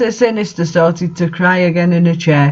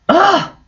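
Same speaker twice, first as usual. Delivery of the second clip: exasperated groan; 12 semitones higher than usual. Real, not acted. Reader exasperated by the text.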